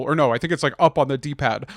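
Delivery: ordinary delivery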